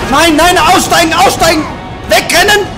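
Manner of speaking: high, loud frantic shouting; panicked yelling. Real, not acted. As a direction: yell orders in a panic.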